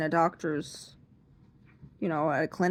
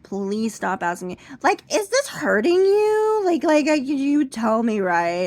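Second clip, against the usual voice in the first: higher, louder, strained insistence; hammed-up insistence; flustered insisting; 3 semitones higher, 6 dB louder, slower than usual. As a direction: beg her to stop asking. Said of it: "And I said".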